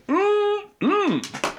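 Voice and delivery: humming through a mouthful; chewing while making sounds; mouth full. Real, not acted. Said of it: "don't talk with your mouth full".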